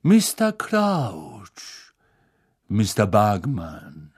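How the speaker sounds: slow, unctuous address; pompous senator. Acted. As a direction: flatter the judges oilily. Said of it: "mit salbungsvoller Stimme".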